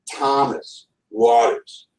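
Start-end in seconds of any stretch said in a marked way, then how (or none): none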